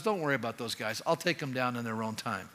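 calm God brushing off the threat. Retold or imitated. Preacher voicing God, low and soft. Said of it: "God says".

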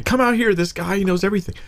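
high, eager shout to the town; excited calling out. Retold or imitated. The woman tells the whole town about him, excited. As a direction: call everyone over, thrilled.

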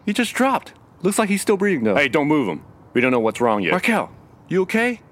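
calling to a collapsed friend; alarmed calling out. Acted.

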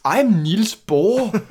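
put-on very slow, high Danish-accented English. Co-host parodies Branagh's Bohr, slow Danish accent. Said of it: "that was good Danish accent".

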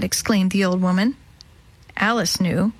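ordinary delivery

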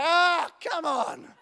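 long, high "Ah" then come on; pumped-up cheer. Retold or imitated. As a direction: act out a drawn-out hyped outburst. Pumped reaction on seeing pastor arrive.

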